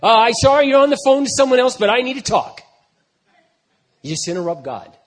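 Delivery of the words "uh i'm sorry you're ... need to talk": high, loud cheery barge-in; loud, bright mock interruption; bold child interrupting. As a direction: burst in on God like a kid. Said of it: "It's like".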